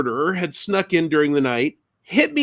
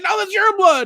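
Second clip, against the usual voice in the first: far higher insistence; mock exasperated correction; wife correcting husband in alarm; wife insisting. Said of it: "And she's like".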